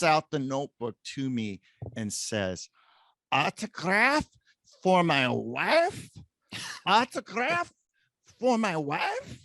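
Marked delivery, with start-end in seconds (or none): 3.3-9.4 put-on high, eager Eastern European accent. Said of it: "in his Eastern European accent".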